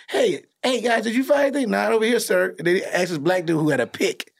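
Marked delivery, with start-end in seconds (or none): 0.1-2.5 higher, fast mock soldier voices; soldiers in movie. Retelling the comb-the-desert scene in mock soldier voices. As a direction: rattle off like dutiful troops. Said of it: "they was asking for everybody".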